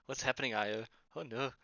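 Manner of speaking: ordinary delivery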